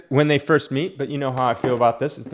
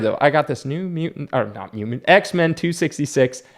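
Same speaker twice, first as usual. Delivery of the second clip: ordinary delivery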